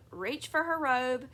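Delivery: usual speaking voice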